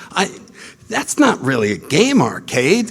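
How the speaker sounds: ordinary talk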